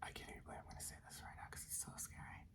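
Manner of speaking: real whisper, very quiet and quick; barely audible hurried whisper; hushed scary aside. Real, not acted. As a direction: whisper it fast, as if scared to say it. Hushed aside about a scary story.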